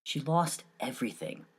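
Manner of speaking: soft, hushed storyteller reading; story narration. A narrator's reading. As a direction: draw the listener into the tale quietly.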